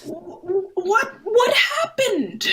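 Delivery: high, slow stammered shock; stretched, high-pitched bewilderment; stunned disbelief. Acted. Stammered shock at the turn of events.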